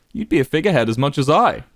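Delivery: ordinary delivery, nothing marked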